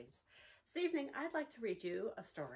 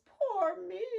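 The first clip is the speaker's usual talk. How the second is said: higher, louder little wail of self-pity; hammed-up storybook wail; mock wail; 9 semitones higher, 10 dB louder than usual. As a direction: wail it like a lost little bear. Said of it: "he wails".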